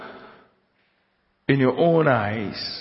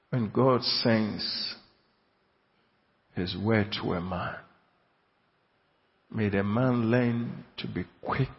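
much softer, low hushed blessing; soft closing prayer; 5 semitones lower, 8 dB quieter than usual. Acted. Preacher closing with a quiet prayer for the congregation, hushed. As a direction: pray it gently over them.

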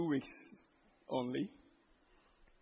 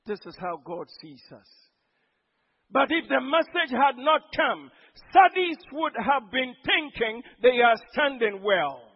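ordinary delivery

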